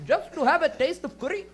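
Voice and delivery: put-on old Indian lady. Comic as stoned old lady, hammed-up Indian accent. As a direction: finish the tale in an Indian accent. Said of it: "high with an old Indian lady".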